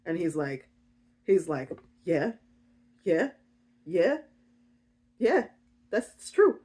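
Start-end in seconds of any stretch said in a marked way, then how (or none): 2.0-6.6 halting little questioning yeahs; mock-nervous, jittery agreement; nervous freaking out. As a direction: stammer agreement, each one rising. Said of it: "He's freaking out. He's shaking".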